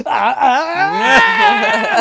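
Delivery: high-pitched imitated shriek; mock scream. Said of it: "like that".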